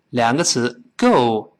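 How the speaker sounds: ordinary talk, nothing marked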